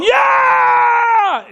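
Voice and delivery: high, loud imitated cheer; mimicked crowd roar, very high. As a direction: cheer like a thrilled bar crowd. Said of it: "And they're going".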